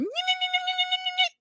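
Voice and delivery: high squeaky chirping noise; lemur chirp. Retold or imitated. Storyteller mimics Melody's worked-up voice as a high lemur chirp. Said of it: "giving very much a chirp".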